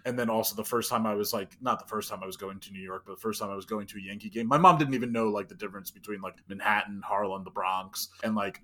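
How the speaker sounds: ordinary talk, nothing marked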